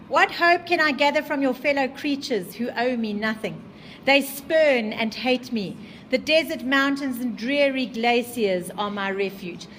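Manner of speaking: loud, slowing on spurn and hate; teacher reads the monster's despair aloud, loud; dramatic reading. Acted.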